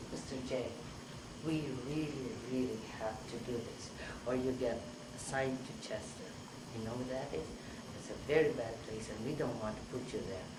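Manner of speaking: soft, careful coaxing; gentle appeal. Retold or imitated. Psychiatrist to catatonic patient about Chester, softly coaxing. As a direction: reason with him quietly, earnestly.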